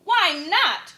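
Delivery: high loud cheering cry. Acted. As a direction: cheer up at him loudly. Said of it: "cried ... with a super loud cheer".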